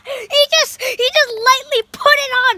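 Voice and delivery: high, loud burst of laughter; cracking up, voice up high; laughing. Real, not acted. Cream smeared lightly on his face.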